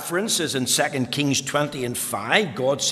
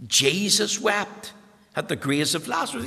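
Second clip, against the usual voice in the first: ordinary talk, nothing marked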